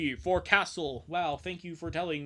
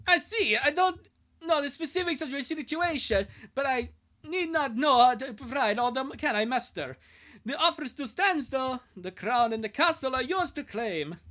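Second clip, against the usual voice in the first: Scottish voice; 8 semitones higher, 5 dB louder than usual. Acted. Scottish steward Angus welcomes the kids home in Scottish accent. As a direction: boom it out like a Scottish castle keeper. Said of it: "the Scottish voice".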